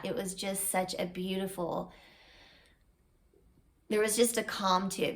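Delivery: ordinary talk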